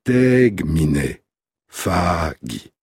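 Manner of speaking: slow, measured recitation of Latin hexameter; scanned Latin verse. Shown as a demonstration. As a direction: recite it syllable by syllable, beating the meter. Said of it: "Écoutez". (récitation lente et scandée d'un hexamètre latin)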